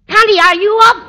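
high, loud call through the house; loud, high wake-up call. Acted. Landlady calling Connie to get up.

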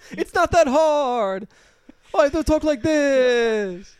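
slow, high, loud Sopranos-style voice; Dick Vitale Sopranos voice. Pretend. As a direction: drag it out like a TV mobster. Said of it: "Dick Vitale on the set of The Sopranos".

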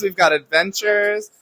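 ordinary talk

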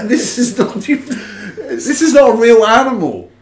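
laughing while saying it. Real, not acted. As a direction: laugh it out in disbelief. Laughing through disbelief.

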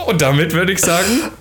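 talking through laughter; words breaking with giggles; laughing. Real, not acted. Still laughing after reading.

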